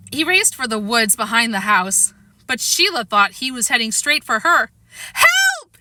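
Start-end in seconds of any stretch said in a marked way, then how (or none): 5.1-5.8 high shrill cry for help; scream. Acted. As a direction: scream it from the wobbling swing. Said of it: "she screamed".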